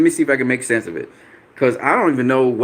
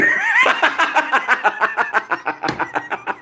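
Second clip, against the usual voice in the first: big laugh; 12 semitones higher than usual. Real, not acted. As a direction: burst out laughing at the comment. Laughing at a chat comment.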